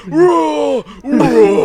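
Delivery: very loud, high drawn-out roar; mock roar. Tech imagining scaring the patient, mock roar. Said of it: "going like".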